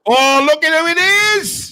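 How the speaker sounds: high, loud delighted surprise; oh look who it is, thrilled; surprised greeting. Real, not acted. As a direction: greet the new arrival with a big lift.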